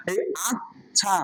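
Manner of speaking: আচ্ছা slowly split, first half held, then the second; split doubled consonant. Shown as a demonstration. Teacher showing how letters join, আচ্ছা slowly broken in two. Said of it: "এই প্রথমে ধরছে".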